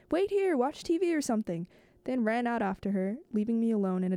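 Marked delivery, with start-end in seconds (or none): 0.1-1.7 a higher, lightly raised call over the shoulder; calling back. A narrator's reading. Yuichi calling back to Mikage while running out, light call. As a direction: toss it back as you run out the door. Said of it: "Yuichi called back to me".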